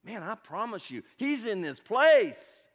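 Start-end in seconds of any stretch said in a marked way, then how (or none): 1.1-2.6 rising preaching climax. Acted. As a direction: build to a shout of conviction. Pastor climaxing the sermon.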